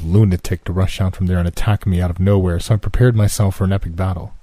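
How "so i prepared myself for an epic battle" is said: ordinary delivery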